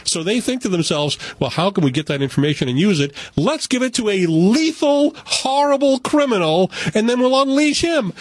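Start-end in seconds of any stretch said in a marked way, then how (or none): 1.4-8.1 mock scheming. Mocking the plot's logic.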